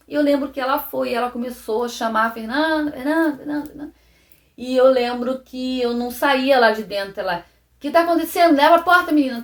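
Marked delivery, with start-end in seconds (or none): 2.3-3.9 higher, repeated call down a school hallway; calling out for a missing child; calling a name. Retold or imitated. Woman calling for the girl. 7.8-9.4 higher, louder, anxious push through the door; worried school aide at the door; urgent worried questions. Retold or imitated. Worried woman at the bathroom door.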